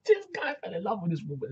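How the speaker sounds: high-pitched, amused delivery; laughing. Real, not acted. Host teasing the rugby player's crush, laughing. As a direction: say it through rising laughter. Cracks up retelling the match.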